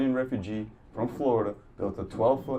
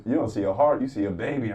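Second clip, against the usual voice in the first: ordinary talk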